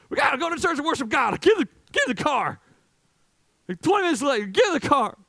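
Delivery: high, loud, hurried parent shouting; hammed-up rush to get everyone in the car; mock frantic parent yell. Parents rushing kids to church.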